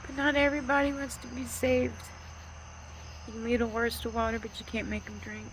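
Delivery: high, tearful voice pushing through advice; fighting tears to finish the thought; still emotional. Real, not acted. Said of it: "Sorry, I'm getting emotional".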